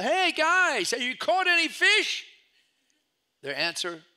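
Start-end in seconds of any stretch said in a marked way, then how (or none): 0.0-2.3 high, loud call across the water; yelling out. Retold or imitated. Jesus calling out to the fishermen from shore, loud. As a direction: shout to the boat far offshore. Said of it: "He yells out".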